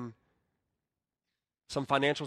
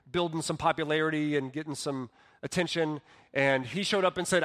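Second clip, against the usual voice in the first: normal talking voice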